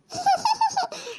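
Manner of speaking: high-pitched mocking laugh. Girl laughing at the boy begging for a pencil, high and mocking. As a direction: laugh him down for asking.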